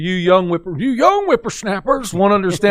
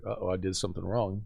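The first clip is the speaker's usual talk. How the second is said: much lower, softer, quick worried thought; worried inner thought; 10 semitones lower, 13 dB quieter, faster than usual. Retold or imitated. Student driver realizing his mistake, quick hushed worry. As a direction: mutter it to yourself as it dawns on you.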